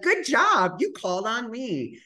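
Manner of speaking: high-pitched mock congratulation; acted pat on the back; pat-on-the-back praise. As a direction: praise it in a high, patronizing lilt. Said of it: "like, a pat on the back".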